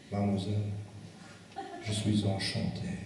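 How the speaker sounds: a hint of a smarmy French charmer; annoying French accent. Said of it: "in that annoying French accent".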